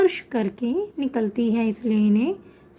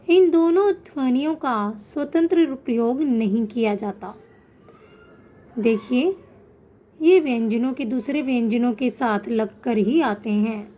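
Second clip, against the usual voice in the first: normal talking voice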